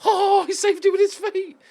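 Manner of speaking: high, excited burst of praise; excited exclamation. Real, not acted. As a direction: yelp it out as the keeper stops it. Keeper save in live play.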